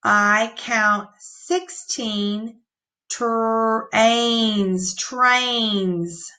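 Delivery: put-on slow, word-by-word reading for children; slow modeled reading; slow read-aloud. Shown as a demonstration. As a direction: read it out slowly, one word at a time. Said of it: "Let's read that sentence".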